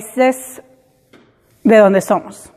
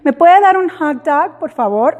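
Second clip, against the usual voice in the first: put-on higher voice, "hot dog" in an American accent; English-accented hot dog; 4 semitones higher than usual. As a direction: order "hot dog" with the American sound, as you wouldn't. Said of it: "no le decía".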